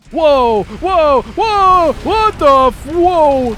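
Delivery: loud, high startled whoas; startled yells. Real, not acted. Streamer yells when the game suddenly jumps, startled whoas. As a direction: yelp in shock at the screen.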